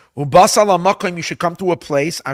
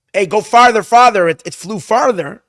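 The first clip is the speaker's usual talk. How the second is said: higher, louder call, urging him on; 5 semitones higher, 7 dB louder than usual. Retold or imitated. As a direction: wave the lad farther off. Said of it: "if I say to the lad".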